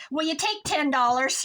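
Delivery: loud, high, pushy bargaining line; Lisa's eager offer. Retold or imitated. Friend cutting in on the seller, a loud eager offer. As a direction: jump in with the offer, bright and loud. Friend Lisa haggling at a sale.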